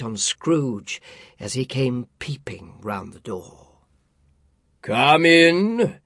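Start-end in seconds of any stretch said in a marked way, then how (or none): 4.8-6.0 big hearty spirit voice; booming ghost. A narrator's reading. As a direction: call out like a jolly giant. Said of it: "exclaimed the ghost".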